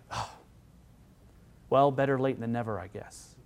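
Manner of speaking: a half-hearted, unimpressed welcome; grudging sigh. Retold or imitated. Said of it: "and shake his head and say".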